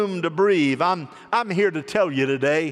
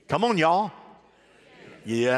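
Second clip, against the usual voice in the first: ordinary delivery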